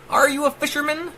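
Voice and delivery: taunting. A narrator's reading. The AI taunting Rat from the air, high mocking tone. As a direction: toss the question up high to mock him. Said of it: "taunted the air".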